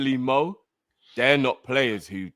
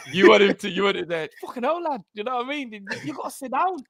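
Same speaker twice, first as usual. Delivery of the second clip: high-pitched Scouse attempt drifting into Geordie; failed Scouse, near Geordie; 12 semitones higher, faster than usual. Co-host showing what he meant, muddled Scouse-Geordie accent. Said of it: "I think that was, like, Geordie".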